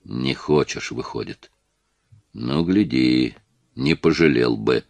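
low, quiet menace, slow on гляди; a soft-spoken veiled threat; menacing. A narrator's reading. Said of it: "с угрозой произнёс".

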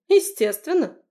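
high, loud scoff; snorting. A narrator's reading. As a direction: toss it off with a scoff. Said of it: "фыркнула Лерка".

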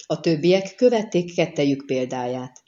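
ordinary delivery, nothing marked

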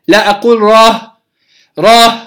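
راه ending on a breathy h; wrong rāh. Shown as a demonstration. Teacher imitating students who run out of breath, an h ending. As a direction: show راه with a cut-off breath. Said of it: "نفسه ينقطع في آخرها".

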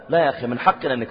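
ordinary delivery

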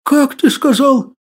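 slow, high, horrified question. Acted. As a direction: ask it in dawning horror. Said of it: "ужаснулся Василий".

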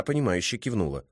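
ordinary delivery, nothing marked

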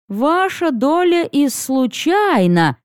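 slow phrase with "случайно" drawn out and pitched high; stress on last word. A narrator's reading. Harter teases about the treasure share, pointedly stressing "случайно". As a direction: lean pointedly on "случайно", stretching it out. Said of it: "выделил интонацией последнее слово Хартер".

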